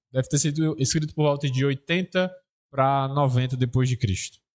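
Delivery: ordinary talk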